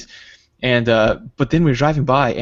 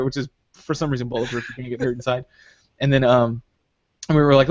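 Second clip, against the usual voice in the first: ordinary delivery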